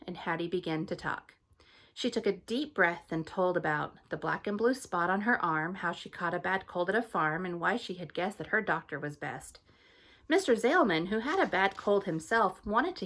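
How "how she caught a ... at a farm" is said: ordinary delivery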